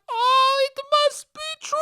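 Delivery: very high hammed-up sobbing; mock crying that breaks into a loud howl; wailing aunt. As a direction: wail it like a gullible, grieving aunt. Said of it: "then my aunt".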